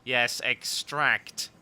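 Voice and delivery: drawn out. Acted. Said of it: "attempt to extract".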